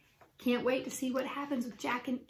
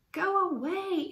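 high, drawn-out shooing cry; raised, higher scolding voice; storybook shout; 7 semitones higher, slower than usual. A narrator's reading. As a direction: shout to chase someone off, pitched up. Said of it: "Annie shouted".

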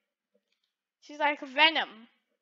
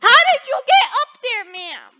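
high, loud, fast questioning shout; incredulous yell; 6 semitones higher, 11 dB louder than usual. Pretend. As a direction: demand it in loud disbelief. Repeats question in disbelief.

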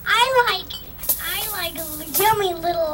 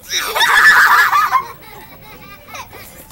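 high, loud giggling shriek; shrieking laugh; 13 semitones higher, 8 dB louder than usual. Real, not acted. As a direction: burst into a squealing laugh. Laughs after playing.

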